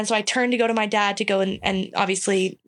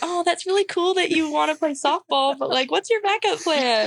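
high, sing-song teacher voice; sweetly doubt her dream; condescending teacher; 7 semitones higher than usual. Retold or imitated. Teachers asking a girl about softball, patronising and high. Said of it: "teachers growing up are like".

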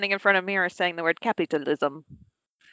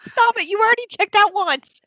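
high, loud exclamation; shocked disbelief; 14 semitones higher, 5 dB louder than usual. Real, not acted. Friend hears of another shopping haul, loud high disbelief. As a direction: blurt it out, scandalized.

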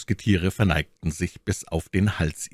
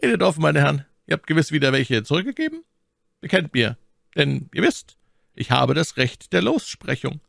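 lightly voiced cardinal, pitched up; inquiring cardinal; 11 semitones higher than usual. A narrator's reading. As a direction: press them to confess. Voices the cardinal pressing them.

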